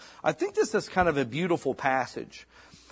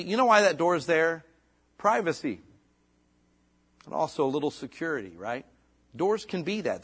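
ordinary delivery

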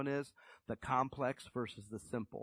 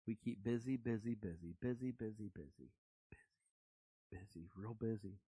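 softer and softer busy, busy; fading repetition; 2 semitones lower, 8 dB quieter than usual. Acted. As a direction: let each busy fade away. Repeating busy, dying away.

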